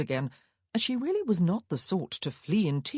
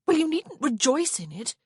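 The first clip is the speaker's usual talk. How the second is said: high, prim and peevish; missish; 10 semitones higher, 5 dB louder than usual. A narrator's reading. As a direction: sulk it primly like a miffed young lady. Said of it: "Prudence said missishly".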